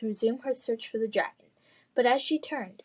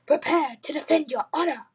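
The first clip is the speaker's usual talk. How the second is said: put-on French accent; 5 semitones higher, 4 dB louder than usual. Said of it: "in a thick French accent".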